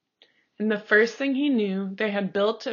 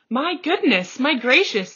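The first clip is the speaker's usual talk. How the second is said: higher, louder amazed exclaim; shouted in wonder; 5 semitones higher, 5 dB louder than usual. A narrator's reading. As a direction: marvel out loud. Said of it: "they shouted".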